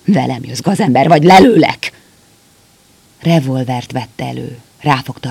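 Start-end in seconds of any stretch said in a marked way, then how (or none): 0.1-1.9 loud, rising threat; shouting threat. A narrator's reading. Soldier threatens the doctor at gunpoint, shouting. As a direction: bark the ultimatum at him. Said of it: "Ő is ordított már".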